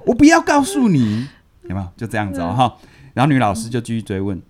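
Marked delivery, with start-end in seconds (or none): 0.1-1.3 put-on high, slow childish taunt, louder; teasing refusal. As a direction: sing it out like a stubborn kid.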